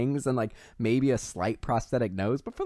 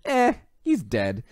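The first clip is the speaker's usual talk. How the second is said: offhand shrug; 6 semitones higher, 4 dB louder, slower than usual. Retold or imitated. Animals shrugging off the death.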